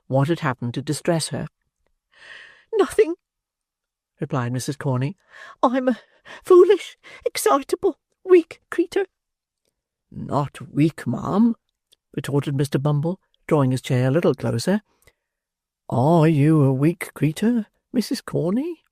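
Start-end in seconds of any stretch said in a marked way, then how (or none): 2.7-9.1 very high, fluttering, ending in a whisper; self-pitying matron. Acted. Matron coyly calling herself weak to the beadle. 10.1-18.8 wooing beadle. Acted.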